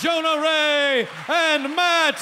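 slow, high stage announcer call. Acted. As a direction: bring them on like a showman. Host announcing guests on stage.

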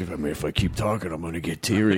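low-voiced tough guy admitting tender feelings; sensitive tough guy. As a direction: drop low, mock-sensitive. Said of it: "like, sensitive".

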